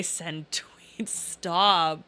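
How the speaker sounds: hushed joking whisper; teasing whispered aside. Acted. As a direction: drop to a whisper for the punchline.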